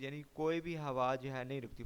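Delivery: normal talking voice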